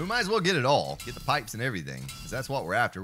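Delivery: ordinary talk, nothing marked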